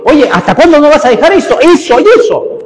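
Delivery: a hammed-up nagging church member; nagging scold. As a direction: harangue him for never changing. Acted scolding of another believer.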